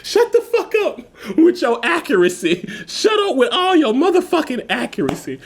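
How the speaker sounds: high-pitched mock outrage; indignant retort. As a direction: squeal it in exasperated mock protest. Retelling his retort to accurate age guesses.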